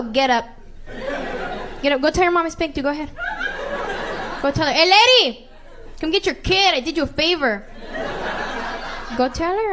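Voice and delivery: put-on telling a spanked kid to go tell his mom, loudly; loud command.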